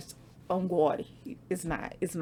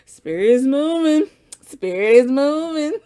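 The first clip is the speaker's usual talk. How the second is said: high, loud, thrilled call breaking into a laugh; excited proclaiming; 7 semitones higher, 9 dB louder than usual. Real, not acted. Reader tells her viewers the spirit is moving, excited and loud. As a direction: shout it out like the news is too good to hold in.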